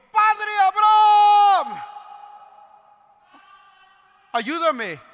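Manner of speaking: very high, loud drawn-out scream. Acted. Rich man screaming to Abraham for help, desperate. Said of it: "Y gritó".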